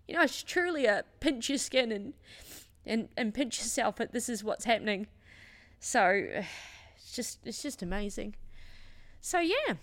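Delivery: real tearful, moved speech with sighs. Real, not acted. Coach moved by her community's lifting party, tearful. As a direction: sigh through welling tears, halting between phrases. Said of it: "I didn't think I was gonna cry".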